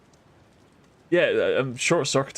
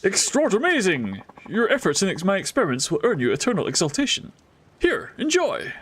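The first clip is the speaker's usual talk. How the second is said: voiced NPC accent; 4 semitones higher than usual. Streamer reading the inventor's reward line in a put-on accent. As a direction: proclaim it like a grand eccentric inventor. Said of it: "This accent has changed".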